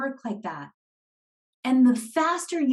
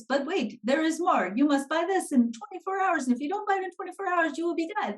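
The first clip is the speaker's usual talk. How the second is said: high-pitched mock infomercial pitch; hammed-up hard-sell voice; parody salesperson; 5 semitones higher, faster than usual. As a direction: imitate a pushy salesperson, pitched up and speeding up. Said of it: "salespeople talk like this".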